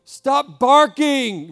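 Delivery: slow, loud stern command to a dog; scolding a puppy. Retold or imitated. Pastor scolding his little puppy, slow loud stern command. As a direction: draw it out like telling off a puppy. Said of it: "Kinda like I say to my little puppy".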